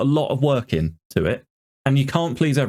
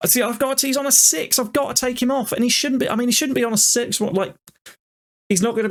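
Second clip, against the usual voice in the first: exasperated rant; 10 semitones higher than usual. Real, not acted. Ranting about the low rating.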